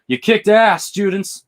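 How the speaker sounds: loud, high mock congratulation; imitated proud cheer. Sensei praising his students, imitated loud cheer.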